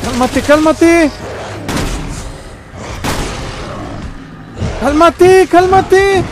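high-pitched plea to an attacker; súplica aguda. Real, not acted. Pleading with an attacking game enemy. (súplica con voz muy aguda)

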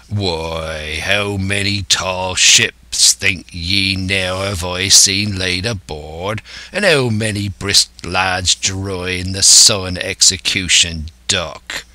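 put-on old sea-dog's drawn-out rhetorical question; indignant pirate cry. Silver warning against haste, indignant pirate. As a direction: roll it out in broad West Country. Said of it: "cried Silver".

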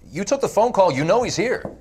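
high, pressing, insistent; urgent plea. Acted. Sergeant begging interrogators to stop Solomon, urgent. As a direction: plead hard, voice climbing in pitch.

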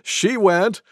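ordinary delivery, nothing marked

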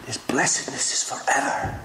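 whispered, unvoiced, for emphasis. Real, not acted. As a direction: lean in and breathe it out. Preacher whispers the repeated line.

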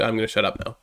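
ordinary talk, nothing marked

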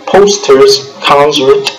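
normal talking voice

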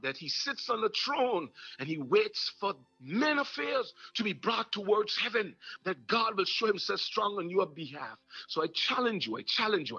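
rising, fervent sermon cadence; preaching. Acted. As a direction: preach it to the congregation with fire. Preaching at full force.

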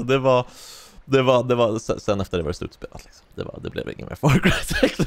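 usual speaking voice

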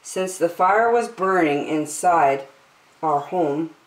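ordinary delivery

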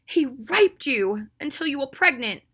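slow, loud, high accusation; devastated rage. A narrator's reading. Ginny accuses Draco of hurting her friend, in devastated rage. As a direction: hurl each word out slowly, devastated and furious. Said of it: "shaking with devastated rage".

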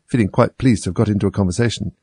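ordinary delivery, nothing marked

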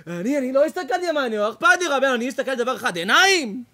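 loud, high, insisting on the eyes; young groom protesting to the rabbi, loud; indignant young man. Retold or imitated. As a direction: plead your case to the rabbi loudly.

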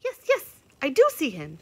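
high, loud excited squirrel; chattering squirrel. A narrator's reading. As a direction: squeak out an eager yes. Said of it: "chattered the squirrel".

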